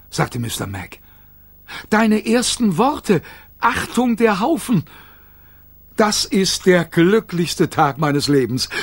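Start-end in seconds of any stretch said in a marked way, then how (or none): 1.9-8.7 higher, slower, beaming voice; overjoyed father. A narrator's reading. Mister Mac thrilled by Kayla's first words, higher and slow. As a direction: gush with fatherly joy.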